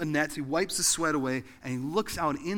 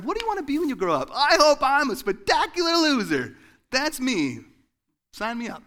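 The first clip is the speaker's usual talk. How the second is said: put-on much higher and louder, a bright eager kid; mock kindergarten answer; 12 semitones higher, 11 dB louder than usual. Preacher mocking a kindergartner's dream, high and loud. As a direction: play a gleeful child signing up to be a loser.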